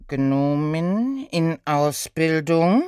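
slow, drawn-out disbelieving question; incredulous centaur. Acted. Centaur questioning the apprentice, slow and incredulous. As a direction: stretch it out, rising at the end.

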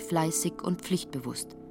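ordinary talk, nothing marked

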